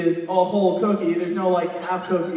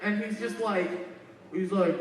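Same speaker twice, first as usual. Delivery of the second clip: ordinary talk, nothing marked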